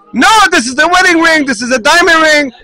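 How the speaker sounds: protesting. Retold or imitated. Husband protests giving up ring.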